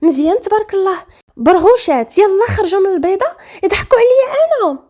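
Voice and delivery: high, outraged tirade; yelling. A narrator's reading. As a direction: rail at them as if betrayed. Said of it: "بدا كيغوت وكيقول".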